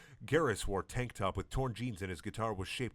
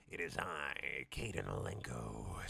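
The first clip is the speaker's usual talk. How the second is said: much lower, slow and soft reveal; dramatic reveal; 8 semitones lower, 9 dB quieter, slower than usual. Acted. Mystery man revealing his name, low slow dramatic voice. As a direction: unveil yourself slowly from the dark. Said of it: "with revelations".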